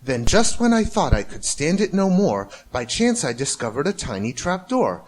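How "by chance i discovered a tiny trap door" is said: ordinary delivery, nothing marked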